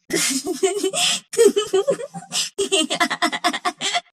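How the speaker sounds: long, high cackle building from a chuckle; gloating laugh. Acted. Gloating over the body count, long high laughter.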